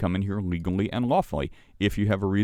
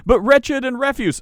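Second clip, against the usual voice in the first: much higher, louder whiny objection; mock-indignant high protest; 6 dB louder than usual. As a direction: squeak it out like a caught-out complainer. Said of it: "They're like".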